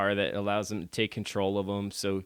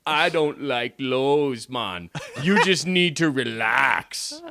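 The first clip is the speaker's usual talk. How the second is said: slow, drawn-out mock Jamaican; laid-back put-on Jamaican voice; Jamaican accent; 3 semitones higher, 3 dB louder, slower than usual. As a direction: stretch it out as an easygoing Jamaican boat. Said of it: "And the ship's like".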